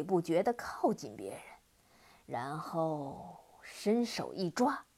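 low, loud demon voice, smug and boastful; gloating laugh. Acted. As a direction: brag like a pleased monster. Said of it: "黑蜂王得意地大笑说".

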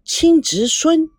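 slow, high, stunned echo; drawn-out shocked question. A narrator's reading. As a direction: repeat it slowly in disbelief. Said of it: "赵腾龙愣住了".

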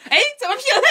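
high puzzled ask, laughing; high quizzical voice. Retold or imitated. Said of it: "问说". (高声疑惑地问)

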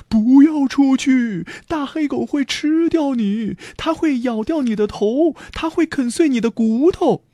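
high, wailing warning; frightened family crying out; alarmed cries. A narrator's reading. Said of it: "大家惊叫着".

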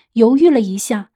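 ordinary delivery, nothing marked

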